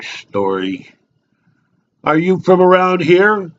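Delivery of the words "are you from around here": nosy local, pitched up; chatty barfly. Acted. Local sizing up an unknown drinker at the bar, nosy and chatty. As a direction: probe like an over-friendly bar regular.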